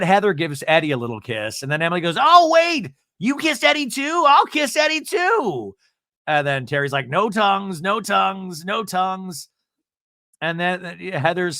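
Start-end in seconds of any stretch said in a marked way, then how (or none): 2.2-5.7 very high, loud, gleeful imitation; excited mock squeal. Imitating drunken Emily wanting kisses too. 7.1-9.5 high-pitched imitated plea, repeated evenly; mock warning. Imitating Terry's warning during kisses.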